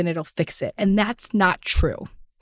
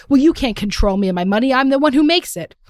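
mock defensive, louder comeback; defensive retort; 5 semitones higher, 5 dB louder than usual. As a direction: snap back defensively at the husband. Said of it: "So I'll go on the defense".

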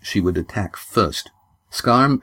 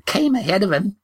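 put-on very high, slow old woman's reasoning; old grandmother; 11 semitones higher, slower than usual. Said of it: "the grandmother went on".

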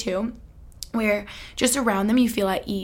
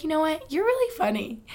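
higher, airy voice of a friend; higher-pitched admiring compliment; friend praising; 7 semitones higher than usual. Retold or imitated. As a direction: lightly voice the friend's sincere praise. Friend Lily praising her, voiced.

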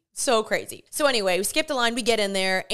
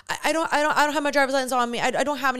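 high, rushed retold excuse; flustered stammer; 3 semitones higher, much faster than usual. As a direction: stumble quickly over the excuse. Nervous stammer to police officer.

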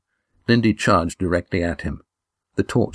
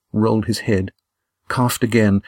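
ordinary delivery

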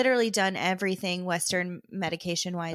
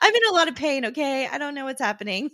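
high, loud flustered plea; flustered excuse; 7 semitones higher, 7 dB louder, faster than usual. Retold or imitated. Kristen excusing herself to the doctor, high flustered plea. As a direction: beg them to understand the pain.

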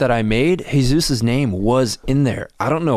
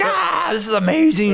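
laughing delight; 10 semitones higher than usual. Retold or imitated. Dying laughing alone at 3am.

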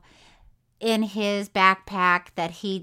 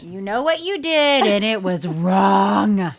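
loud, slowing into a drawn-out last word; hammed-up righteous telling-off; mock stern scolding; 2 semitones lower, 6 dB louder, slower than usual. Host scolding a pretend wrongdoer, loud mock indignation. Acting out a citizen's arrest.

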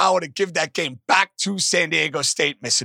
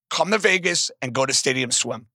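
ordinary talk

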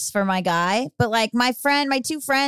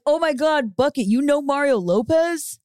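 made-up character voice; 3 semitones higher than usual. Pretend. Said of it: "I have a deep voice".

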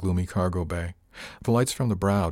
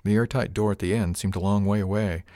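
plain reading voice

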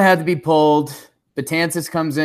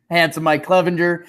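ordinary talk, nothing marked

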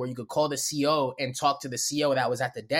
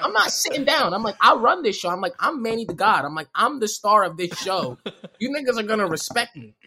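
mock defiance; 5 semitones higher than usual. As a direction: declare yourself the star, refusing orders. Re-enacts defying guards ordering him down.

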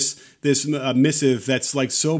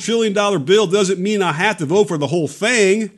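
put-on Mississippi accent; 6 semitones higher than usual. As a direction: imitate a Mississippi senator's accent. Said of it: "can I do my Mississippi, uh, my Mississippi accent?".

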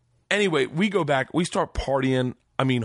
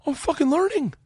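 much higher, giddy self-congratulation; delighted he finally understands; thrilled realization; 13 semitones higher than usual. Retold or imitated. Student thrilled at understanding the gangster's Russian, much higher. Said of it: "I'm like".

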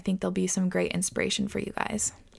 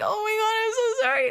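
much higher, imitating a crying little girl; tearful apology. To her fourth grade teacher, a mock tearful apology. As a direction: squeak out the apology like a tearful kid. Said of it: "and I started crying".

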